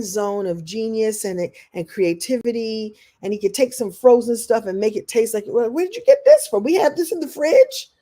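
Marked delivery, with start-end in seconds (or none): 5.5-7.9 high, fast, amazed praise. Retold or imitated. Wife marvels at husband's cooking, amazed and high. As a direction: gush with surprise at the food. Imitating her own amazed reaction.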